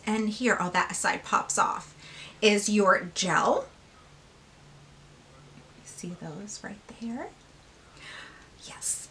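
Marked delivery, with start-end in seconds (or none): 5.8-9.1 soft delighted whisper. Real, not acted. Showing viewers the gel eyeliner, whispered. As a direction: hush it, sharing a secret.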